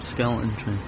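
quiet mumble; barely audible mumble; mumbled. Acted. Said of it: "I can't hear you".

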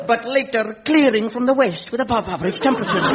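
quick, bright forecaster read; mock weather forecast. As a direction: rattle it off like a radio forecast. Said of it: "mock weather forecast".

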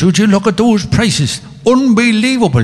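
high, bright Irish brogue; Irish accent. Retold or imitated. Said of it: "the Irish accent".

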